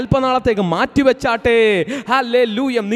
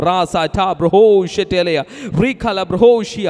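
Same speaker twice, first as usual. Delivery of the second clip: slow, low ecstatic tongues; speaking in tongues; 4 semitones lower than usual. Real, not acted. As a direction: pour out syllables in tongues.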